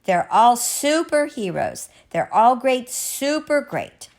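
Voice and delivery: high, emphatic praise; cheering. Acted. Mr. Matu praising the farmers, cheering. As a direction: proclaim it like a cheer. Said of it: "Matu gave a cheer".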